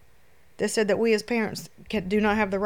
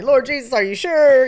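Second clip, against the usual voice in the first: muffled pillow scream; 6 semitones higher, 10 dB louder than usual. Retold or imitated. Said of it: "I'm screaming in my pillow".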